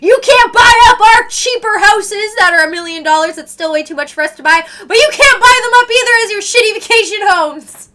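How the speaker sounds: high, loud mock protest; hammed-up shouted outrage with a laugh in it; indignant Canadian. Canadian telling off American house buyers, mock-indignant shouting. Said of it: "Where's Canada's energy when it comes to that?".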